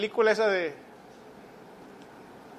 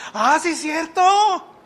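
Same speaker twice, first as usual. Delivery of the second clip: mock sudden recollection; 5 semitones higher than usual. Preacher plays a listener whose memory is refreshed, mock realization. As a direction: act as if it just dawned on you. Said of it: "ya se le refrescó la memoria".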